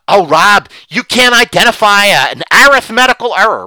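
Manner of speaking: loud, high mocking retort; mock objection. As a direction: throw back the critics' objection loudly. Acted critics' standard objection.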